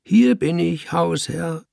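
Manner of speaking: high, teasing call; mimicking call. A narrator's reading. Cat calling in the man's voice, mimicking. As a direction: call out behind his back. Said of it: "rief ich und wieder mit seiner Stimme".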